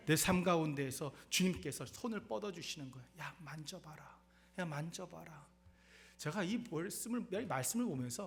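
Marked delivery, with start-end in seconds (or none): 3.2-5.5 soft, slow gentle invitation; tender Jesus. Retold or imitated. Jesus to his disciples, soft and coaxing. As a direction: coax them gently to reach out.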